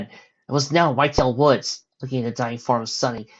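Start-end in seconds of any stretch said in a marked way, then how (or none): none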